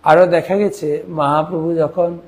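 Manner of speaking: ordinary delivery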